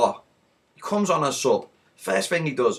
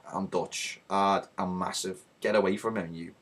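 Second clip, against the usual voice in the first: Van Dijk; 7 semitones lower, 9 dB quieter than usual. Acted. Defender warning off Richarlison, low quiet menace. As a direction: play the massive enforcer, deep and soft. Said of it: "Van Dijk comes over to Richarlison and is like".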